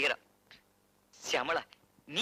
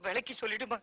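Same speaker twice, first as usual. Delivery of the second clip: ordinary talk, nothing marked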